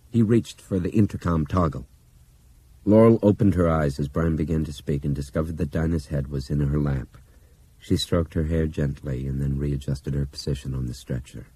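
usual speaking voice

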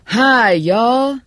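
big, high hollered greeting; enthusiastic shout. A narrator's reading. Candidate on a van roof greeting the crowd, shouting enthusiastically. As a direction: belt it out to a crowd, full-lunged. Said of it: "shouted into it with leather-lunged enthusiasm".

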